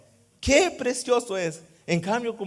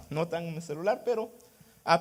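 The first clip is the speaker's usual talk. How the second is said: normal talking voice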